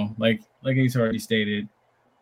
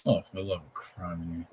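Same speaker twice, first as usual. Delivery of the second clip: ordinary talk, nothing marked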